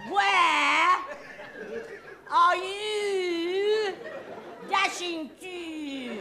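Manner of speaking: loud and high, drawing it out; repeated shouting. Shown as a demonstration. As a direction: shout it at her across the street. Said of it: "I said".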